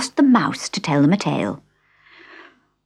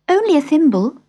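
put-on young girl voice; 12 semitones higher, 5 dB louder than usual. Alice voiced.

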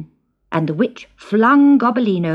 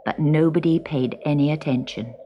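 usual speaking voice

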